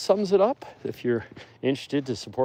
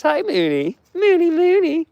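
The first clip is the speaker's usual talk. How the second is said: much higher, louder cooing pet talk; 6 dB louder than usual. Real, not acted. Cooing at wolf Mooney.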